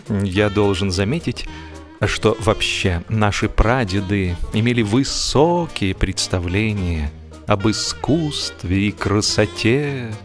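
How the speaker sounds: usual speaking voice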